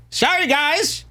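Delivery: a cheeky high-pitched apology; mock apology. Host teasing the podcast guests with a high mock sorry. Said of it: "mock apology after boast".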